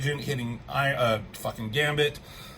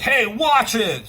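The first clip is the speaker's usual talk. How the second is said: loud, high, slow mock-angry warning; getting mad; 8 semitones higher, 6 dB louder, slower than usual. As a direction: act out an irritated Hulk snapping. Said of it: "Hulk is getting mad".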